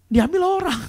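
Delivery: loud, very high imitated wail; mock crying. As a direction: wail it out in pretend tears. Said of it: "nangis depan saya". (tiruan meratap keras)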